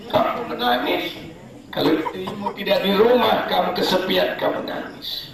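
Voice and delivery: high, teasing with a laugh; scoffing at the husband's tears; mocking jab. Real, not acted. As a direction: poke fun at lonely husbands.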